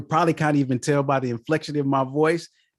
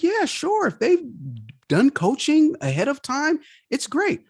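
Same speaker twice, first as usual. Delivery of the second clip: much higher, lightly mimicking a woman client; eager life coach; 13 semitones higher than usual. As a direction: play the lady suddenly agreeing, bright and keen. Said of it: "She said".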